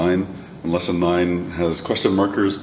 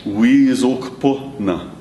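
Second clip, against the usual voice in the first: slow demo; 4 semitones higher, much slower than usual. Shown as a demonstration. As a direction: say it slowly for learners. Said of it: "The question was".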